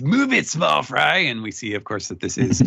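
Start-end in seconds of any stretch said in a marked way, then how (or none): 0.0-1.2 lightly voiced cocky rival, pitched up; rival's taunt. Host voices Kid barging in, lightly taunting. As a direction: push in with a mock rival's jeer. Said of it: "a rival voice says".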